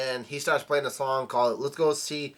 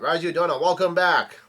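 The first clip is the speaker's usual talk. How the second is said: mild, higher welcoming call; retold yell; 4 semitones higher, 5 dB louder than usual. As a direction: call it out like an excited crowd, moderately. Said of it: "the minks yell out".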